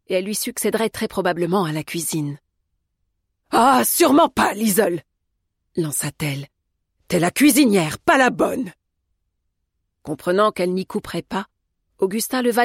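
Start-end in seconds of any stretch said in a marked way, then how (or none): 3.5-5.0 loud, raised-pitch veto; sharp scolding protest; indignant objection. A narrator's reading. Cook forbids her protégée to go. 7.1-8.7 louder, emphatic put-down; cross, forceful reprimand; indignant scolding. A narrator's reading. Cook scolds her protégée.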